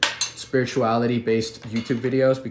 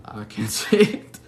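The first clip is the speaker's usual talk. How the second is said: laughing; 6 dB louder, slower than usual. Real, not acted. Stumbling over a viewer's name.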